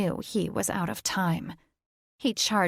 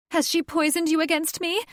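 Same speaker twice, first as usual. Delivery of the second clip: much higher, rising hurt shriek; shrill accusation; 10 semitones higher, 3 dB louder than usual. Acted. Catherine accuses Thaddeus of betrayal, high and shrill. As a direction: wail it up at him.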